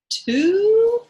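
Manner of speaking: high squeak of shock; the mouse catches its breath; gasping squeal. Acted. The storyteller squeaks as the startled mouse. Narrator acts mouse gasp.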